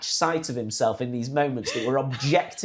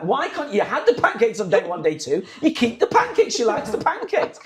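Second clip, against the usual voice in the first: high, loud, indignant demand; mock shouting match; 10 semitones higher, 4 dB louder than usual. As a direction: argue like a furious grandpa over breakfast. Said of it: "a full-blown shouting match".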